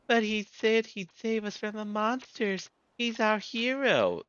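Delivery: put-on little girl, high and grateful; high child voice. Small girl thanking her rescuer, high child voice.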